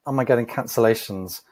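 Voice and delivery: ordinary talk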